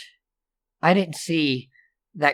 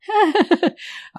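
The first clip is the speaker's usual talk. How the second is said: a sudden high giggle; 3 dB louder than usual. Acted. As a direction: giggle it out, caught cussing. Counselor laughs at herself.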